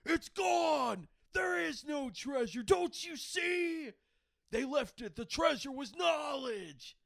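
high, drawn-out hammed-up shouting; Nick Cage freak-out. Hero finding the treasure gone, hammed-up freak-out. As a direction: wail it like a despairing movie star. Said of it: "classic freak out that he always has".

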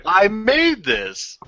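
slow, smug mock pride; mock proud, slow. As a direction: drag it out, fake proud. Echoing the quote, slowly.

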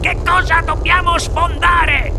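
put-on high, startled old grandfather; high-pitched, worried demand; alarmed question. A narrator's reading. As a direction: press the question in rising panic.

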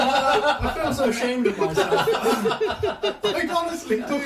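high, helpless laughing through the words; laughing confession. Real, not acted. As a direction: squeak it out while laughing. Helpless laughter over the accent fiasco.

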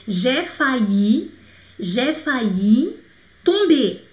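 very slow "J'ai failli tomber", rising then falling; slow model sentence. Shown as a demonstration. Teacher modelling a French sentence very slowly. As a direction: drag it out so learners catch each word. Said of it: "slow model sentence".

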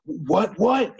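imitating a guy getting his back up; defensive what. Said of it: "They go".

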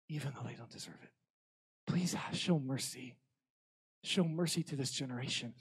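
soft, halting plea; tearful pleading. Retold or imitated. Youth pastor interceding for the kids, tearful plea. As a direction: beg gently for mercy, understated tearfulness. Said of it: "middle of the youth room weeping".

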